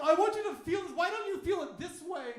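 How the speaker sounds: high, loud mock demand from a woman; mock woman arguing. Woman in an argument demanding you feel it, high mock voice. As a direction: push it up high like she's insisting. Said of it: "Every time a woman wants you to get into an argument".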